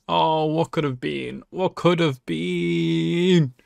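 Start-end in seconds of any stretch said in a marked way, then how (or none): 1.5-3.5 very slow, dragged-out wistful repeat; slow wistful echo. Real, not acted. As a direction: stretch it out, mourning what was lost. Echoes own line, drawn out.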